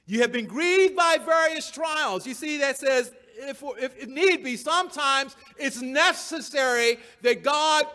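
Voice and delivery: loud, high pulpit delivery; impassioned preaching. Acted. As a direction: hammer each stressed word at the congregation. Preaches at full force.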